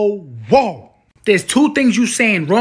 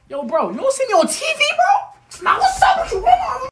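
much higher, laughing yell; high shouting at viewer; 14 semitones higher than usual. Acted. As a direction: break character and holler at the viewer. Breaks character to yell at viewer.

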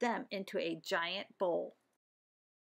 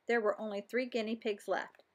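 usual speaking voice

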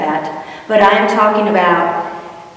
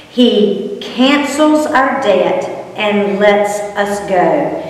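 slowed right down, each word weighed; slow, deliberate emphasis on cancels; much slower than usual. Real, not acted. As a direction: lay it out slowly so it sinks in. Stressing the key verse.

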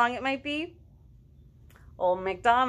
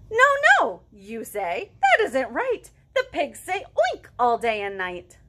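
lively picture-book read with animal sounds; reading to children; 4 semitones higher, 7 dB louder, slower than usual. A narrator's reading. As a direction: perform each animal for little listeners. Reads Moo Ba La La La aloud.

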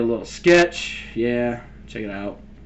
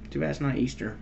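normal talking voice

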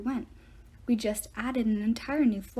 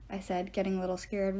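ordinary delivery

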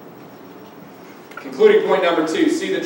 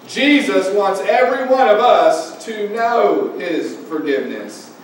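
usual speaking voice

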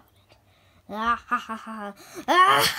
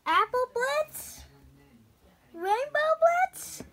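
high, loud calls searching for friends; calling out; 13 semitones higher, 6 dB louder than usual. Acted. Pony calling out for her missing friends, loud and high. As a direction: shout the names up into the air.

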